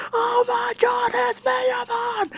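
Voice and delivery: overexcited high-pitched screech; imitating a gamer's hyped-up, very high scream; Maximilian Dude. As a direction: screech it like an overexcited fan spotting a hero. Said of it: "he was already overexcited".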